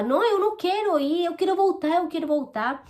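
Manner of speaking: high-pitched protest, not shouted; retold cry of protest. Woman retelling her refusal as she was taken away, protesting. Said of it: "eu gritava".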